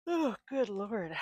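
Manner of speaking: slow words stretched by a yawn. Real, not acted. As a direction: talk through a big yawn. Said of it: "I cannot stop yawning".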